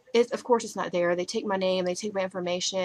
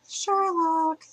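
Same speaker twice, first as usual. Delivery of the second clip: excited; 8 semitones higher than usual. Real, not acted. Showing off her phone cover.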